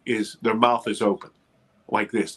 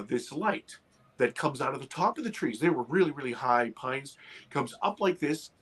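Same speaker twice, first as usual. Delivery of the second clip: normal talking voice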